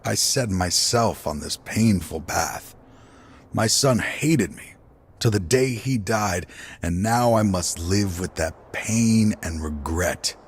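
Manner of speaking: ordinary talk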